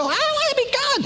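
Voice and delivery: very high, hammed-up wanting; mock self-important demand. Man wanting to run his own show, mock demand.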